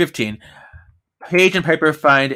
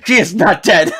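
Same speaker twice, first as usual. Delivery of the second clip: loud, raised hammy retort; mock exasperated shout; 7 semitones higher, 7 dB louder than usual. Playing Leo carrying Piper downstairs, mock exasperated shout.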